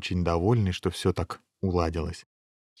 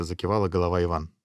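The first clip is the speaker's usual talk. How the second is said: ordinary delivery, nothing marked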